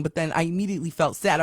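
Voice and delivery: ordinary talk, nothing marked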